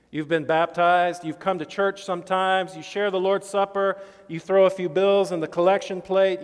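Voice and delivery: rising, forceful sermon list; preaching. Acted. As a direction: build it up like a preacher.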